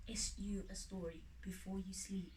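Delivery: breathy bedtime whisper; soft whispered lullaby line. A narrator's reading. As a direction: whisper it to the sleepy chick. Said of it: "whispering slowly".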